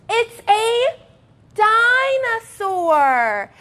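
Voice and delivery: high, slow gasping reveal; excited discovery; surprised. Acted. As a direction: wow the kids with it. Revealing the toy.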